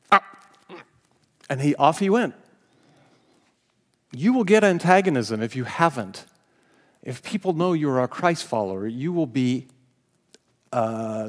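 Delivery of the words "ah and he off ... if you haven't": usual speaking voice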